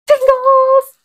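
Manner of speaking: high, loud burst of "Tingles!"; excited outburst. Real, not acted. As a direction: blurt it out, thrilled and shaken. Goosebumps moment in story.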